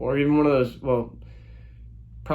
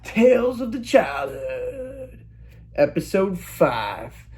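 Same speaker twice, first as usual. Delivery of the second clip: slow, high, drawn-out announcer intro; slow epic title announcement; dramatic show title; 6 semitones higher, much slower than usual. Acted. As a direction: announce the series title grandly and slowly. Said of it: "Another episode of".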